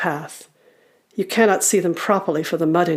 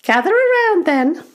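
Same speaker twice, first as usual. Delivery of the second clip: high, loud goblin call; goblin storyteller; 13 semitones higher, 6 dB louder than usual. Acted. Goblin gathering the children for a story, high call. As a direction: call the little ones round, high and loud. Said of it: "says the first goblin".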